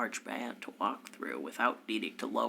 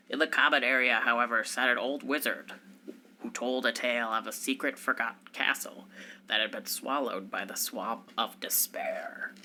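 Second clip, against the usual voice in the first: normal talking voice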